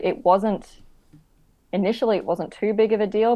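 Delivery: ordinary delivery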